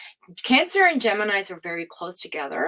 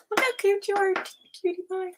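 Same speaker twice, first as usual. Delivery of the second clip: cooing; 12 semitones higher than usual. Real, not acted. Doting on a viewer's photo, high cooing baby talk.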